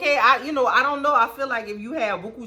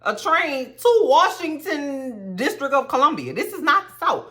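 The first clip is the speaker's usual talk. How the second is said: incredulous retort; 6 semitones higher than usual. Retold or imitated. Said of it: "And I'm like".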